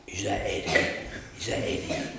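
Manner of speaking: imitating a would-be tough phone voice; put-on tough voice. Broken man ringing the minister, putting on a tough voice. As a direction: try to sound tough, but only half manage it. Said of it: "put on a real tough voice".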